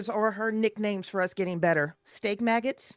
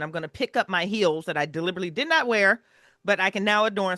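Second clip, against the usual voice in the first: ordinary delivery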